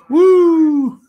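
loud, high cheer; excited whoop. Real, not acted. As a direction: let out a big happy whoop. Cheering after show name.

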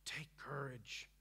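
breathy hushed whisper, slow and soft. Real, not acted. Pastor softly urging the hurting congregation, whispered. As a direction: lean in and breathe it like a secret.

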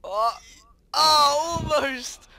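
high, loud near-miss cry; excited shout. Real, not acted.

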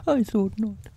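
high-pitched bear character voice; storybook bear, pitched well up; Pooh. A narrator's reading. Pooh taking leave of Rabbit, high bear voice.

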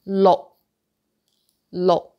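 "lo" short and punched, ending in a silent K; stopped K. Shown as a demonstration. Teacher repeats number six, "lo" with stopped ending. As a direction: chop "lo" off without releasing the K. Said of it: "no K sound".

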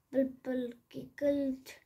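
ordinary delivery

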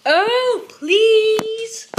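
high, loud, drawn-out plea; whiny child's shout; pleading shout. A narrator's reading. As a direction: beg at the top of your voice. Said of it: "he shouted".

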